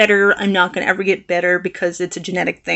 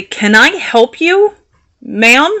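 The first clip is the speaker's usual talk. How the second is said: higher, louder, drawn-out polite challenge; slow, testy courtesy with a rising end; pointed fake politeness; 4 semitones higher, 5 dB louder, slower than usual. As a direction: ask it politely but mean back off. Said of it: "What the hell do you want?".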